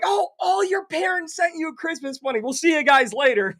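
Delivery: high, sing-song feigned innocence; mock innocence. Coaches pretending parents sent the money, high mock-innocent voice.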